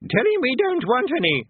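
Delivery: put-on high, loud, deaf old man; doddery old wizard. A narrator's reading. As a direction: shout like a deaf old codger. Arch-Chancellor voiced.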